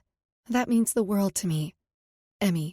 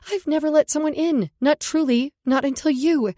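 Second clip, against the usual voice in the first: raised, breaking declaration; emotional outburst; 6 semitones higher, 7 dB louder than usual. A narrator's reading. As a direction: pour it out, louder and higher.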